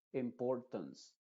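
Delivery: "Importance" con la A final como schwa; A muy cerrada. Shown as a demonstration. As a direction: di "Importance" lento, con la última vocal cerrada. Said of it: "una A muy cerrada que es una schwa". ("Importance" con vocal final reducida)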